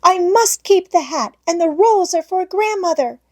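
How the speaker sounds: put-on high, pleading little girl; desperate child. A narrator's reading. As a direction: beg to keep the bread for Grandmother.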